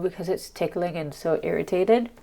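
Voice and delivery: ordinary talk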